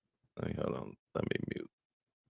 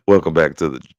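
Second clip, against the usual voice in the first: louder radio host welcome; 10 dB louder than usual. Retold or imitated. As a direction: greet listeners like a DJ. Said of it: "a real D J".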